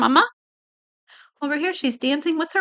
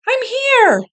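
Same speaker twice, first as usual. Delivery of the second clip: high, louder announcement of arrival; bright arrival call; 12 semitones higher, 4 dB louder than usual. A narrator's reading. Betty announces her arrival in story.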